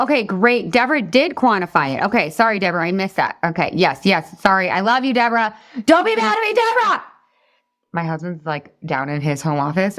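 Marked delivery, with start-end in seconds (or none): 5.9-7.0 a much higher squeal of pleading apology; playful apology. Real, not acted. Coach calling to viewer Deborah not to be mad, high playful squeal. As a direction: beg forgiveness in a bright, far higher squeal.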